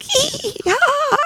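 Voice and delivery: very high, soft giddy giggle; giddy squeal laugh. Retold or imitated. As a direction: squeal like a teenage girl. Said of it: "I was like".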